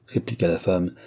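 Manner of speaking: ordinary delivery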